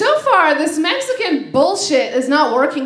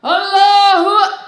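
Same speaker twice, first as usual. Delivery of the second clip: loud, drawn-out chanted cry; bomber's battle cry; 5 semitones higher, 6 dB louder, slower than usual. Acted. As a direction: chant it out at full volume, cut off abruptly. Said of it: "he's like".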